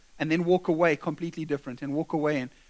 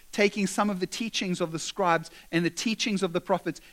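ordinary talk

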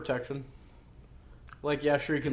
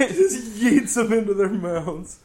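slow, laughing through the words; 8 semitones higher, 8 dB louder, slower than usual. Real, not acted. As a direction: giggle it out, high and loud. Laughing at movie scene.

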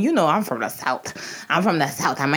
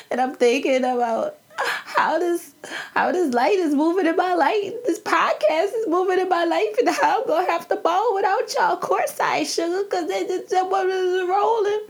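real emotion welling up while talking about lost friends; choked up, near tears; getting emotional; 13 semitones higher than usual. Real, not acted. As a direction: voice climbing high and catching as feelings rise. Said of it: "I got in my feelings".

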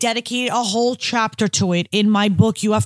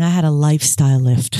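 deadpan confession; 7 semitones lower, 3 dB louder than usual. Real, not acted. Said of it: "I love the way you just said that".

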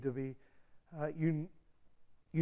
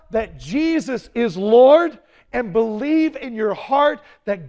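scripture reading; 4 semitones higher, 14 dB louder than usual. Acted. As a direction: proclaim each word of the verse. Reads scripture with force.

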